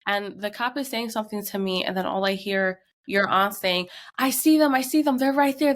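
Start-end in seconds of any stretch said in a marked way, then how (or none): 4.2-5.7 raised, higher excited shout; urgent calling out. Retold or imitated. Aunt alerting mother that she sees them, urgent calling out. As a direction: call out that you've spotted them.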